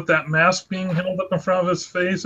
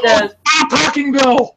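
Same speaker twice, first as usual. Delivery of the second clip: loud, high-pitched yell; raised voice, pitched well up; real shouting; 9 semitones higher, 7 dB louder than usual. Real, not acted. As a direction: shout it to cut someone off. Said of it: "Stop shouting".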